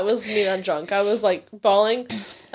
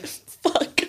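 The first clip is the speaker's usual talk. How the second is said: breathy whispered fuck, higher; whispered curse; 8 semitones higher, 3 dB quieter than usual. Retold or imitated. She realizes she met them and forgot, whispered. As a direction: mutter it under your breath.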